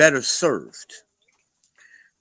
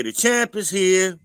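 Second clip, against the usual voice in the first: greeting call; 6 semitones higher, slower than usual. Retold or imitated. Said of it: "I always say".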